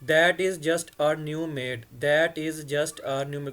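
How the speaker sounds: slow model reading. Shown as a demonstration. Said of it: "क्योंकि मेड दरवाजे पे है".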